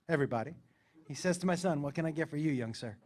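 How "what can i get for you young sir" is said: soft, low courteous server; polite waiter. Retold or imitated. Waiter taking the boy's order, soft and polite. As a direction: ask softly and courteously.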